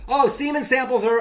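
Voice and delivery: loud, high mock announcement; loud clerk. Clerk announces it loudly, mock loud high voice. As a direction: blurt it out for the room to hear. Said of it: "we don't need to yell".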